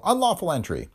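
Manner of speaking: high, triumphant, falling tune; pouncing accusation. A narrator's reading. District attorney pouncing on the detective's break-in, triumphant. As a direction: catch him out with Unlawful entry. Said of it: "Ha!" said Bagel".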